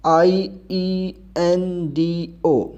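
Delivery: slow spelling of I E N D O, each letter apart; spelled letter by letter. Shown as a demonstration. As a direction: spell out I, E, N, D, O one by one. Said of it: "यानी".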